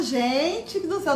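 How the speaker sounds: ordinary talk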